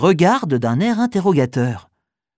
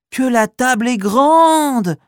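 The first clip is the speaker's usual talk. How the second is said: amazed exclamation; 7 semitones higher, 3 dB louder, slower than usual. Acted. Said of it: "s'exclame Malika".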